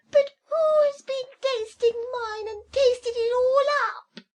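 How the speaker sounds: very high, loud, tearful little bear; teeny tiny voice. Acted. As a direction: squeal like a tiny bear about to cry. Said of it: "cried the poor little teeny tiny bear in a teeny tiny voice".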